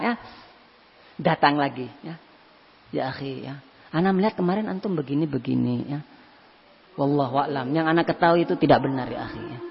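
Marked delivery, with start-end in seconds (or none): 2.9-9.6 soft advice. Retold or imitated. Friend softly advising again.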